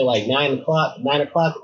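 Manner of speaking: ordinary talk, nothing marked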